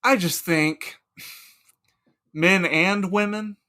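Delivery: loud, leering philosopher; eager Socrates. Acted. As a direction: sell it with hungry enthusiasm. Socrates pushing male-only games.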